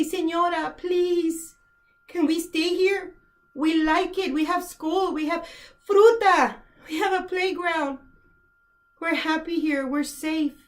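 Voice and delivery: put-on high, small voice of children begging; imitating pleading children. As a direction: voice the little kids pleading to stay. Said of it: "The children would cling to my leg and say".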